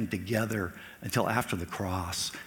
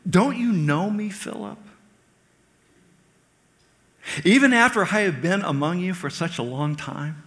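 higher and louder, pleading and reproachful; Jesus pleading with Philip, louder; raised rebuke; 8 semitones higher, 5 dB louder than usual. Acted. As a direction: press him with hurt disbelief. Preacher voicing Jesus's rebuke.

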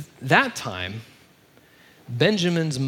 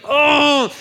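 high, loud mock wail of dismay; hammed-up freak-out, easing down toward the end; 7 semitones higher, 4 dB louder than usual. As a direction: act out brothers in a panic, pitched way up. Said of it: "they're just freaking out".

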